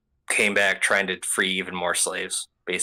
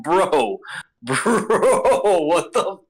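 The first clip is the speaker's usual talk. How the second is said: higher, louder, laughing through it; cracking up in exasperation; laughing disbelief; 10 semitones higher, 5 dB louder, much slower than usual. Retold or imitated. Reacting to the noble's stupidity, laughing disbelief. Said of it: "It's like".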